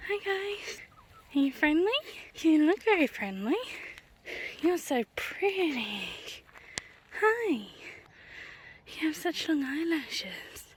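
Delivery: high sweet voice for cows; talking to animals. Real, not acted. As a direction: coo gently to the animals.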